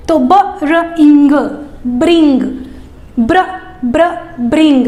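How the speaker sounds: "ब्र" cluster said twice, then joined into "ब्रिंग"; sound blending. Shown as a demonstration. Teacher showing how to teach bring. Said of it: "इस तरह से बोल करके सिखाना है".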